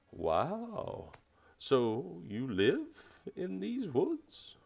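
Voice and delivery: slow, drawn-out wonder climbing high; shocked turtle. Acted. Turtle hears where the furbolg comes from, shocked and slow. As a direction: stretch it out in amazed disbelief. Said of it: "he's very shocked at that".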